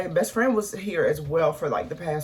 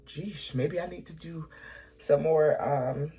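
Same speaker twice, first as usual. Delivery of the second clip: softer voice, still fighting tears; trying to steady herself and shrug it off; choked up; 4 dB quieter than usual. Real, not acted. Vlogger to viewers, softly choked up, trying to shrug off tears. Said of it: "I'm getting so emotional".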